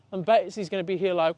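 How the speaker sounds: ordinary talk, nothing marked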